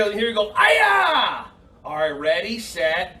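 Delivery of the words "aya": a high, loud karate yell; loud shout. Shown as a demonstration. Teacher showing kids how loud to yell, high shout. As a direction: belt it so the class can hear. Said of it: "Well, I can't hear".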